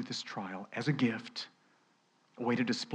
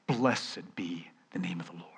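mild mournful Job; 4 semitones lower, 7 dB quieter than usual. Retold or imitated. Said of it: "And he said".

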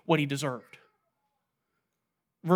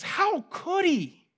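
indignant disbelief; 11 semitones higher, much slower than usual. Pretend. Listener judging the wicked slave.

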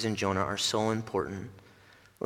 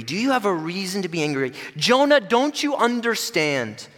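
higher and louder, urgent; impassioned plea; 9 semitones higher, 6 dB louder than usual. Acted. Preacher presses the question on the congregation, high and urgent. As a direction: plead with the listener.

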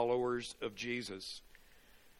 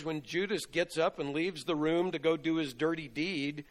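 ordinary talk

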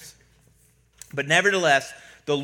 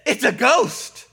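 raised, louder cry of fright; mock alarm; 7 semitones higher, 6 dB louder, slower than usual. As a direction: imitate the startled disciples calling out. Disciples crying out in fright.

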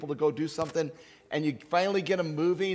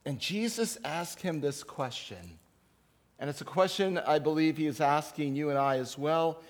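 usual speaking voice

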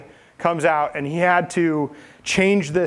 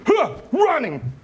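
high, slow startled gasp; hammed-up fright; mock alarm; 10 semitones higher, slower than usual. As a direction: gasp like a spooked animal. Zechariah fleeing like the sheep.

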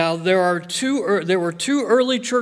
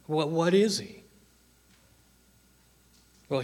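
ordinary delivery

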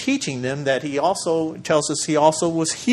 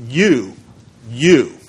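You, then you again, each punched louder; emphatic repeated you; 2 semitones lower, 6 dB louder than usual. Shown as a demonstration. Pastor showing the Greek emphasis, each You punched. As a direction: point at them twice: You, you. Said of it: "It's like saying".

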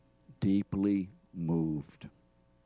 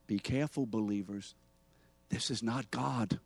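ordinary talk

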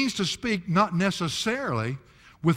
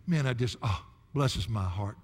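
ordinary delivery

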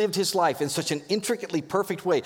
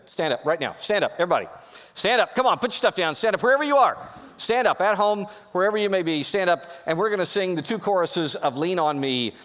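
building to a loud rallying call, higher; 5 semitones higher, 3 dB louder than usual. Real, not acted. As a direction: rouse the whole room to its feet. Pastor rallies congregation to stand.

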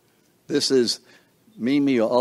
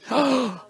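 a quick, high surprised gasp; sharp gasp; 14 semitones higher than usual. Real, not acted. Hears the grandchild's name.

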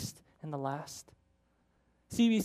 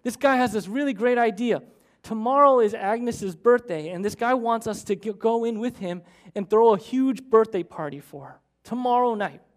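loud, higher-pitched excited yell; calling out; 4 semitones higher, 6 dB louder than usual. Retold or imitated. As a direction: yell to someone in the back room. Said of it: "he yells over to his wife".

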